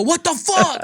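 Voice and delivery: high-pitched comic yell; mock scream. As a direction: cry out in exaggerated disbelief. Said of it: "I just scream".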